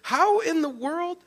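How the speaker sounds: high-pitched, incredulous string of questions; stuttering excitement. Acted. Astonished Naomi firing questions at Ruth, stuttering with excitement. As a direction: sputter questions in rising, squeaky disbelief. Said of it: "like this stuttering of excitement".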